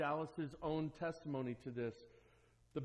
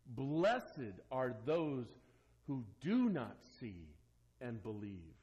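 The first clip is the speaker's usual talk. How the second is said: slow solemn quote; 6 semitones lower, slower than usual. Retold or imitated. Quoting Jesus on blessing.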